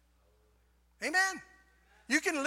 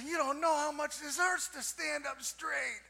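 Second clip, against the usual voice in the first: higher, put-on pained protest; mock complaining; 5 semitones higher than usual. Husband answering wife who said stand up straight, mock complaining. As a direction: play up the ache as a comic grievance. Acts complaining reply to Mary.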